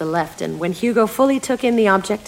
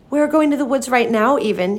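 higher, louder defiant declaration; defiantly; 6 semitones higher, 4 dB louder than usual. A narrator's reading. Hugo defying the babysitter, high and loud defiance. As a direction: throw it at her as a challenge. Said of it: "Hugo said defiantly".

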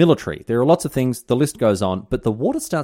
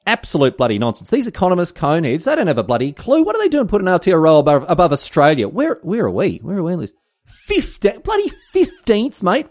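mock outrage; 8 semitones higher, 5 dB louder than usual. Mock Aussie outrage rant.

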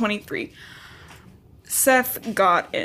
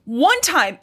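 high, loud mock outrage; hammed-up how-dare-you; outraged mother; 8 semitones higher, 4 dB louder than usual. Mother mocking fury at the wolf who imprinted. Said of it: "She's like".

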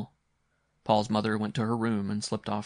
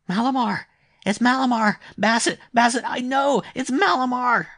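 high, loud delirious screaming; child crying out; 10 dB louder than usual. A narrator's reading. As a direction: shriek it like a feverish child. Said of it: "So the child cried".

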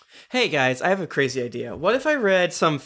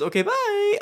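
okay, bye, jumped way up high; a silly high-pitched goodbye; squeaky sign-off. Acted.

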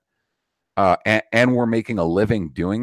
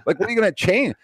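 put-on much higher, scornful disbelief at the kids; incredulous mocking rant; 11 semitones higher than usual. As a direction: pitch it way up, sneering who-do-you-think-you-are. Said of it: "His argument is like".

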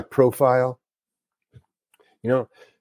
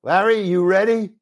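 high-pitched director's call; retold call-out; 6 semitones higher than usual. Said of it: "He yells out".

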